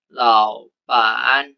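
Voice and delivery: "Laupan" with lau rising, pan dipping low; Mandarin third tone sandhi. Shown as a demonstration. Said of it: "dibacanya laupan".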